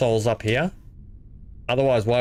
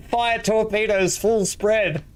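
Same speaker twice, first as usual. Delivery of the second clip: high, slow, hammed-up battle command; captain's order; 9 semitones higher, much slower than usual. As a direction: bark it like a starship captain in combat. Playing starship captain giving orders.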